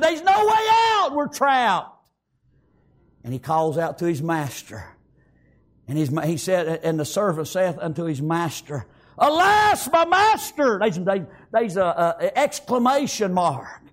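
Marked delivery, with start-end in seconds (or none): 0.0-2.0 high shouted alarm; panicked shout. Acted. Servant sees the Syrian army and shouts in panic. 9.2-10.8 high, loud exclamation; excited cry. Acted. Servant wakes his master with an excited cry. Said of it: "He said this with excitement".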